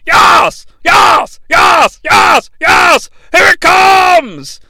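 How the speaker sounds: high, loud, rapid-fire exclamations building to a drawn-out call; imitating a commentator's ecstatic yelling; Vince. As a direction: shout it like an overexcited ringside announcer. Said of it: "Vince is excited".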